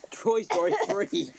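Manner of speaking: ordinary delivery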